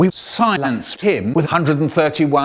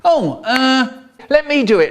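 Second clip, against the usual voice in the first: put-on high, eager kid brother voice; brother's voice; 9 semitones higher, 3 dB louder than usual. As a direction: pipe up keen to take the wheel. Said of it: "My brother said".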